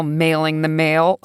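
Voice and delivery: ordinary delivery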